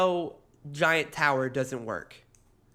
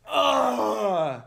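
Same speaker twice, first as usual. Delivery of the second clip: disgusted groan; 7 semitones higher, 3 dB louder than usual. Retold or imitated. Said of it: "everybody else went".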